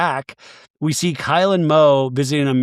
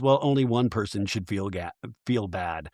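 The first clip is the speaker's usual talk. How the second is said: pointed and quiet; 5 semitones lower, 7 dB quieter than usual. Retold or imitated. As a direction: lower and softer, cutting. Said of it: "And Amanda goes".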